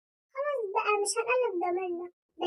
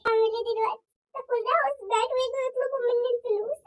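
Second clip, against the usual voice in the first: usual speaking voice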